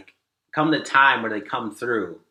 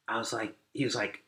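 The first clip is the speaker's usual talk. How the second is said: usual speaking voice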